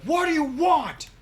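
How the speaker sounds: high, loud, indignant demand; angry knight. Acted. Knight looks down at intruders, angry shout.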